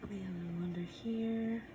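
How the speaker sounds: soft whispered aside; whispering. Real, not acted. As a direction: whisper it under your breath. Painter whispers while working.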